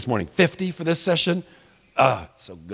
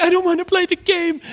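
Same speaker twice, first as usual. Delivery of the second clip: put-on whiny toddler. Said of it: "the three-year-olds are like".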